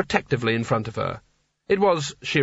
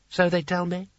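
put-on dreamy old Seraph; 5 semitones higher than usual. A narrator's reading. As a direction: drift through the words like a tipsy old poet.

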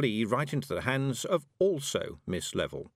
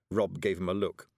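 plain reading voice

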